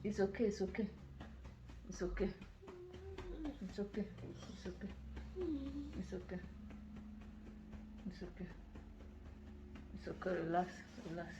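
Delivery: fading to a hushed, repeated murmur; soft soothing. Real, not acted. Mother lulling her son back to sleep, fading murmur.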